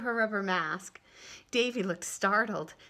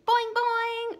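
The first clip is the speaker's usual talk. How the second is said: high loud playful boings; high mocking boing chant; teasing boing; 9 dB louder than usual. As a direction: tease her with bouncy boings. Kids teasing Susan.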